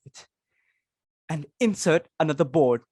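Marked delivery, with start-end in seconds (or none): none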